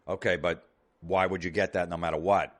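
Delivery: ordinary talk, nothing marked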